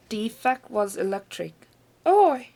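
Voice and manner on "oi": high, loud startled squawk; shrieking hen. A narrator's reading. Hen jolted by the medicine, loud shriek. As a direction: yelp like a shocked hen. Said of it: "shrieked the hen".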